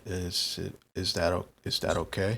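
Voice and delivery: low, soft, halting; timid question. Acted. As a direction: ask hesitantly for permission. Roger timidly checks before drinking.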